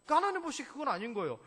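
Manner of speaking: ordinary delivery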